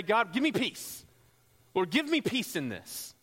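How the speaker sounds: high, loud plea to God; frustrated prayer. Acted. Upset believer going to the Lord for peace, frustrated prayer. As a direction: beg upward with mounting agitation. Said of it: "we get frustrated, we get angry, we get scared".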